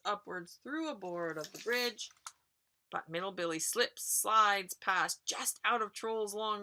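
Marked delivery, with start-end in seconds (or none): none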